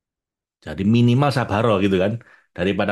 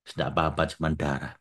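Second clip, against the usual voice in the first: much softer and lower, calm and tender; gentle reassurance; 5 semitones lower, 6 dB quieter than usual. Retold or imitated. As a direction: quietly brush off the pain. Voicing the Prophet gently.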